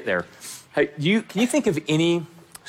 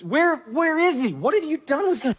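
high, urgent pleading questions; distraught Mary; 10 semitones higher than usual. Acted. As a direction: act out a grieving woman's desperate search. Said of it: "humanize this".

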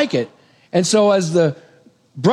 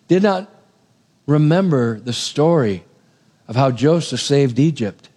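normal talking voice

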